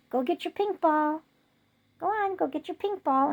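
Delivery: high, encouraging dog-command voice; upbeat pet-owner voice; talking to the dog. Retold or imitated. Sending the dog to fetch.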